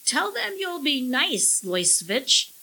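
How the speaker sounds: high, wheedling bird voice; snickering parrot. A narrator's reading. Parrot Long John coaxes the alien, snickering. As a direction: start high and teasing, like a parrot. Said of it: "Long John snickered".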